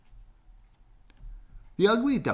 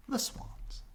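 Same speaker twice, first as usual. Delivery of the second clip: whispered ending; 9 dB quieter than usual. A narrator's reading. Narrator whispering the story's close. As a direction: whisper the last words.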